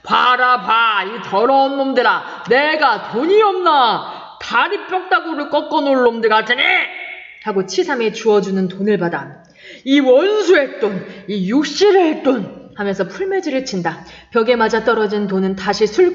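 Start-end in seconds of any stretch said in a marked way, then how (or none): 0.0-6.6 put-on drunken rage. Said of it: "더욱 성을 내며". 9.8-12.7 put-on slow, loud drunken cursing; cursing money. Drunk curses money, throwing it.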